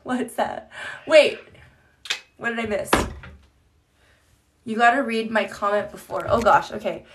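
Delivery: ordinary talk, nothing marked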